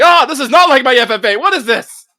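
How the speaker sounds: loud, high shocked yell; shocked shout. Retold or imitated. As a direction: yell out in disbelief. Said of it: "I'm like".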